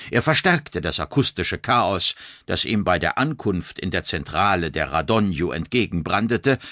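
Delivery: ordinary delivery, nothing marked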